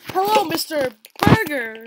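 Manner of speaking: mock interviewer. Playing job interviewer.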